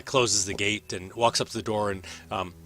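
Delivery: ordinary delivery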